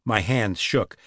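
ordinary delivery

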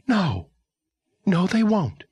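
put-on high, startled girl's voice; stunned young woman. Girl character voice.

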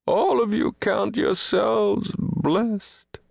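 high, mournful, self-pitying oration; wounded lament. A narrator's reading. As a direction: lament your misfortune to luckier listeners. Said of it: "wounded deeply by this new sorrow".

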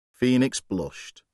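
usual speaking voice

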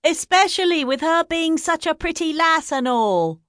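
high, prim gossip voice; a touch of a high village gossip; gossipy sister. A narrator's reading. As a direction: relish the scandal as a busybody.

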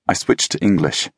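ordinary delivery, nothing marked

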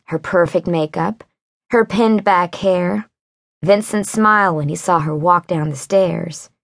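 ordinary delivery

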